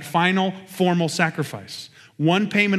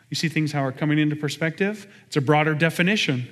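usual speaking voice

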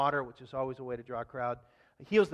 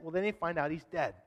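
ordinary talk

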